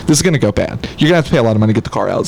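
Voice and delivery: low, rapid warning over the phone; buddy on the phone. Acted. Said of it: "a buddy on the other end".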